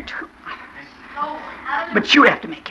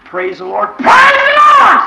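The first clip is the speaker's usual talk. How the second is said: much higher, louder, slow shouted praise; exuberant praise; 8 dB louder, slower than usual. Shown as a demonstration. Preacher showing the church how to praise beyond measure, shouting. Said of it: "What if I do it like this?".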